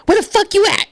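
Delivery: very high mock screech; squeaky, exaggerated friend impression; imitating Tierra. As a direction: mimic your friend demanding answers, way up high. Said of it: "and she be like".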